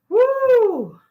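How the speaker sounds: loud, high-pitched "woo"; high whoop. Real, not acted. Host about to start the topic, high whoop. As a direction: let out a high, excited whoop.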